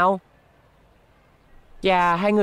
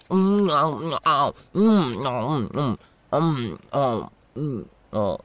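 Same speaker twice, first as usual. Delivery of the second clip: mouth-full mms fading away; munching cake; 5 semitones lower, much slower than usual. Acted. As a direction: hum and chew as if the cake is too good to stop. Vendor munching the cake he's selling.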